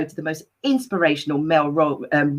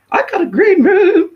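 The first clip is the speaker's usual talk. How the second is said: high, loud joking whoop; mock boast; 8 dB louder than usual. Host joking to viewers about the green room, mock boastful whoop.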